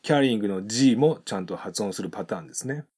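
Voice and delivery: ordinary delivery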